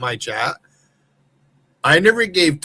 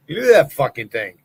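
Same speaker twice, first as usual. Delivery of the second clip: ordinary talk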